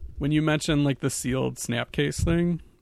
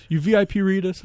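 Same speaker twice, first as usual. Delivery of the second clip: louder, higher mock fan demanding an answer; insistent repeat; 6 semitones higher, 5 dB louder than usual. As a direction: push the repeat harder like a pushy fan. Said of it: "and she's like".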